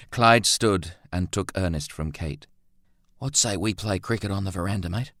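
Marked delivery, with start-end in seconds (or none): none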